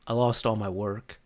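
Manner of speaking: soft, deflated imitation; upset coworker. Coworker admitting lost work, quietly upset. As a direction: confess the loss quietly. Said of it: "She looks upset".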